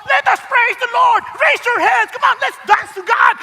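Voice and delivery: loud hammed-up cheerleading worship leader; hyped worship leader. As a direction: ham up an overeager worship leader pushing the crowd. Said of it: "Kawawa, ibang praise and worship team".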